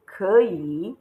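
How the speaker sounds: 可以 with a rise on 可, dip on 以; changed third tone. Shown as a demonstration. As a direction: model 可以 as second tone plus third tone. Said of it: "So you go 可以".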